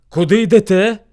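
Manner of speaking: loud demanding question; challenging bear. Acted. Rival bear questions travellers, loud demand. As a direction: challenge passers-by with authority.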